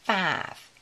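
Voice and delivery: ordinary delivery, nothing marked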